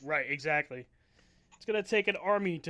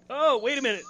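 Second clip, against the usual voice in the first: surprised; 7 semitones higher, 7 dB louder than usual. Real, not acted. Mock surprise at the attack.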